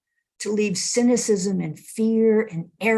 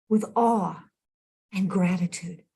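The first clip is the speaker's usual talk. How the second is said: ordinary delivery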